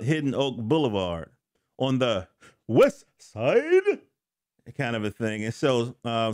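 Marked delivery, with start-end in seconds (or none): 2.7-4.0 hyped shout-out. Pretend. Calling out the neighborhood.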